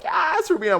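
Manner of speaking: hammed-up high whoop of approval; retold cheer. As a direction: cheer on a favourite in a high squeal.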